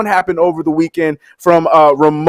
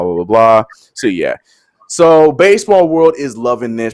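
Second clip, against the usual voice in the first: usual speaking voice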